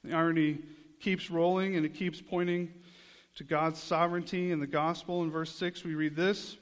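ordinary delivery

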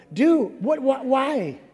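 high, loud, stammering confusion; flustered disciples. Retold or imitated. Disciples sputtering at Jesus, high and loud. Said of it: "See what I did there?".

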